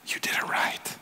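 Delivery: hushed, unvoiced whisper of approval; God's whisper from heaven. God whispering to those starving to hear, hushed whisper.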